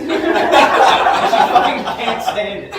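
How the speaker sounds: laughing loudly through the words; real loud laughter over the words. Real, not acted. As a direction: barely get it out for laughing. Laughing while telling.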